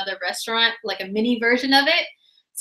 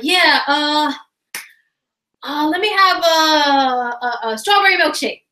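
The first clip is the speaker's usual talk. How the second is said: higher, slightly strained mumbling bum; 4 semitones higher, 3 dB louder than usual. Retold or imitated. A bum fumbles his order at the Taco Bell register, higher voice. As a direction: play the scruffy customer fumbling his order.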